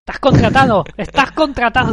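loud, high, exultant cry; excited shout. Acted. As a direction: shout it like a triumphant announcement. Jokingly hiring the synthesizer voice. (grito eufórico)

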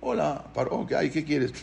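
understated, soft-spoken reply; casual, quiet greeting. Retold or imitated. Moshe arriving unbothered before frantic Paró, soft casual greeting. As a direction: answer softly, as if nothing is urgent.

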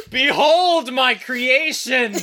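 slow, booming mad scientist cry; megalomaniac rant. Pretend. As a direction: proclaim it like a mad creator. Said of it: "Behold, a man!".

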